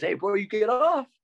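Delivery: high, lightly voiced guard calling over. Guard Santos calling over to an inmate, high. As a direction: call it out like a friendly guard.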